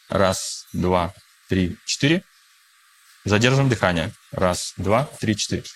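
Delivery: calm, counting breaths aloud; guided breathing. Acted. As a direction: lead the breathing count gently. Leads a guided breathing exercise.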